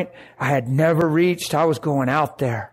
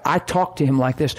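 usual speaking voice

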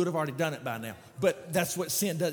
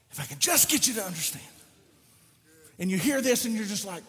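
ordinary talk, nothing marked

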